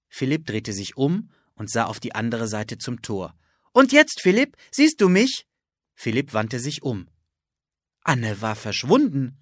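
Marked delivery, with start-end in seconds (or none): 3.7-5.5 much higher, louder excited asking; bursting to know if it worked; girl in the cloak asking her brother, high and thrilled; eager question. Acted. Anne invisible, teasing brother.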